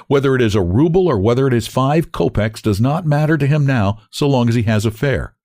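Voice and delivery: ordinary delivery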